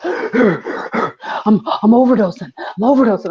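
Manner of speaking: gasping, mildly dramatic mock panic; hammed-up overdose. Man announcing his overdose in the emergency room, mock dramatic. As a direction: imitate a man faking an overdose between gasps. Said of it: "fucking dramatic".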